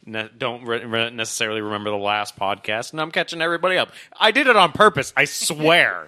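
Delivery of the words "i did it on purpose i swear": loud, high-pitched protest of innocence; mock insistence. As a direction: insist far too hard, jokingly. Jokingly defends forgetting the plot.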